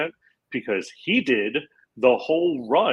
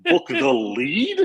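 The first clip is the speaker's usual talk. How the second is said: slow, much higher squeak on book; mock disbelief; 4 dB louder, much slower than usual. Asking the friend who got the lead, hammed-up disbelief. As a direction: stretch out the outraged question, high and slow. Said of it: "And I was like".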